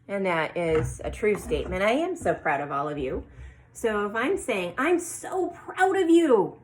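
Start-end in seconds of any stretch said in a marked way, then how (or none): 4.8-6.6 much higher, slow and full of feeling; strong emotion. Shown as a demonstration. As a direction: gush it out like a proud teacher. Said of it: "putting some feeling or some emotion behind that".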